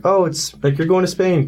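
lifted voice, mimicking others' excitement; excited retold reaction. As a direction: imitate everyone jumping to a conclusion. Retold reaction of everyone else.

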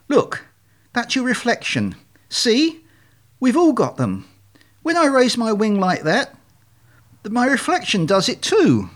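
high-pitched, emphatic explaining; insistent leader swan. Acted. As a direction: swan leader pressing on with a lesson.

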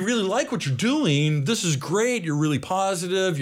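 high, eager placating tone; mock-soothing corporate praise, pitched high; retold reassurance. Retold reassurance from legal department.

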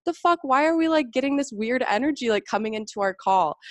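high, startled outburst; shocked disbelief. Retold or imitated. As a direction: react like the card just spooked you. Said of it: "I'm like".

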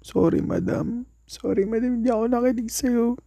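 rising, pleading sorry to teacher; pleading apology. Retold or imitated. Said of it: "sa isip ko".

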